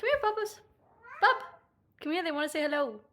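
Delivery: high, coaxing pet voice; calling the cat. Real, not acted. Pet sitter coaxing the cat to greet viewers, sing-song.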